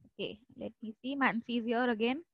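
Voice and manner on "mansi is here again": ordinary talk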